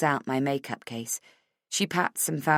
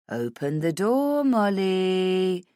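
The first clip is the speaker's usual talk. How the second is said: menacing killer; 3 semitones higher, 3 dB louder, much slower than usual. Acted. Killer at locked bathroom door.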